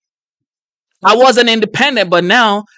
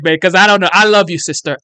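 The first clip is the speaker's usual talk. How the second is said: normal talking voice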